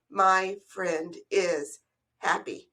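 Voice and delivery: My friend is happy, each word set apart slowly. Shown as a demonstration. Teacher separating the words slowly for the class. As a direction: tap out every word slowly. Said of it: "it's four words and not five words".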